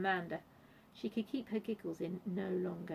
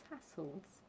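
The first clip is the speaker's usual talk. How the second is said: plain reading voice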